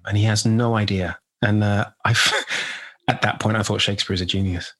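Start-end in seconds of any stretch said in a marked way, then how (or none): 2.2-2.8 short breathy laugh. Real, not acted. Laughing about Shakespeare's genius.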